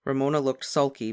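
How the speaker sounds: ordinary delivery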